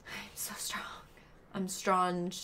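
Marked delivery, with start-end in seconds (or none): 0.1-0.8 whisper. Real, not acted. After "stay strong", holding back tears.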